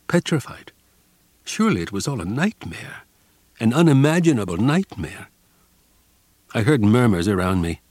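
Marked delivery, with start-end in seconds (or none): none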